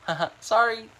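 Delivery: flustered giggle through an apology; nervous laugh. Real, not acted. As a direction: laugh it off, embarrassed. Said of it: "I can't think straight".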